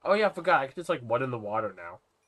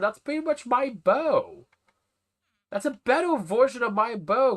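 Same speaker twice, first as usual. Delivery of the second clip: excited high voice; 11 semitones higher, 3 dB louder than usual. Real, not acted. Excited about bow enchantments.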